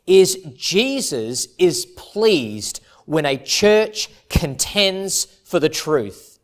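usual speaking voice

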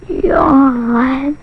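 long drawn-out mock yawn; imitated yawn. Sleepy frog is heard in the story, pretend yawn. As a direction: yawn the word out loosely. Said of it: "there was something that went".

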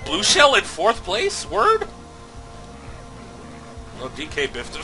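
ordinary delivery

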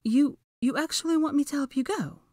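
high hesitant disbelief; hesitant Hermione. A narrator's reading. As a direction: ask as if you can't believe it. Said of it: "she asked hesitantly".

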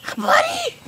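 squeaky high pet voice. Real, not acted. Greeting the turtle Billy in a high baby-talk voice.

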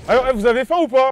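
calling out. Real, not acted. Calling out to the gamers.

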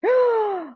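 high, drawn-out gasp of delight; big excited intake of breath; sharp surprised gasp. Real, not acted. Hears season two is out.

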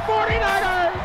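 excited. Real, not acted. Hearst run reaching the end zone.

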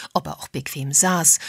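plain reading voice

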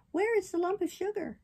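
high little puppet voice; demanding puppet. Acted. Puppet asking the fairy for sugar, demanding. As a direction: demand your treat in a high voice.

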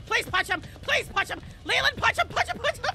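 high, loud frantic pleading; loud desperate urging; urgent yelling. Real, not acted. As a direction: yell at your teammate to fight. Urging teammate during fight.

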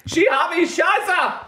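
high, slow hammed-up German shout; mock German shouting. Mock German man warning about his wife on the loo. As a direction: yell it in a silly fake German accent.

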